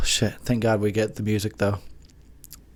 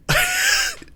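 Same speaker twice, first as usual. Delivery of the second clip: high squeal laugh. Real, not acted.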